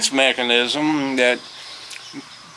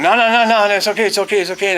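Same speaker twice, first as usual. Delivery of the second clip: flustered reassurance; 8 semitones higher, faster than usual. Retold or imitated. Retold hurried reassurance to kids.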